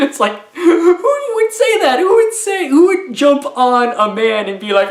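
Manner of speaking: high, loud laughing disbelief; laughing incredulously. Real, not acted. Reviewer cracking up over an absurd line, high laughing voice. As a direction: squeal it through real laughter.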